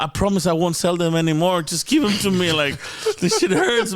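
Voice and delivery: high, mock-desperate begging; joking plea. Patient joking with doctor to get painkillers, mock pleading. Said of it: "cracking some jokes about".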